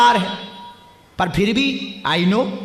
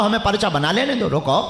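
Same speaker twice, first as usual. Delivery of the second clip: normal talking voice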